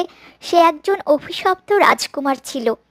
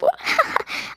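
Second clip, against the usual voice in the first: sly laugh; 13 semitones higher, 6 dB quieter than usual. Acted. Stepmother gloating over her plan.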